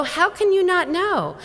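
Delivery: high-pitched retold disbelief; incredulous challenge. Educator to doctors who missed it, incredulous. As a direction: question them in high, indignant amazement.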